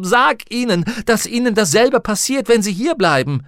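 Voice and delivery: high, loud, insistent tirade; urgent shouting. A narrator's reading. Lyra urges Roger to warn the children, shouting urgently. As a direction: drive the orders home at a yell.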